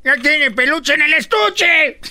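high, loud drawn-out call; loud exclamation. Acted. Comedian capping the boy's description, loud exclamation. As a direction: belt it out slowly, high and loud. Acted crude exclamation in story.

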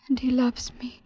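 hushed, urgent whisper. Acted. To her father, whispered defense of her love. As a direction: breathe it to him as a secret. Daughter defying father softly.

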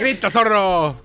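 slow hype-man holler; egging on. Pretend. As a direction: urge a colleague to yell. Egging on a colleague to shout.

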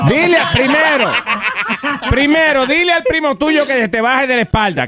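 high-pitched bossy grandma; mock scolding grandmother. Host as grandma instructing her grandson, high mock scolding.

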